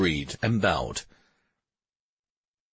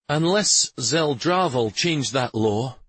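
ordinary delivery, nothing marked

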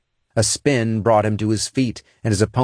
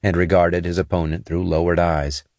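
plain reading voice

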